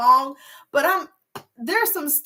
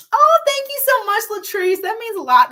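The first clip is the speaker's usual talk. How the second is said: much higher, louder gushing thanks; delighted thanks; 13 semitones higher, 7 dB louder than usual. Real, not acted. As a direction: squeal your thanks to a loyal viewer. Thanking a viewer for praise.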